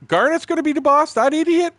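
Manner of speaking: high, loud yell of protest; retold indignant holler; outraged disbelief. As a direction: shout it back in utter disbelief. Retells his own outraged reaction.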